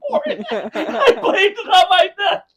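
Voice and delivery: high, loud words through laughter; laughing confession. Real, not acted. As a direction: crack up while admitting it.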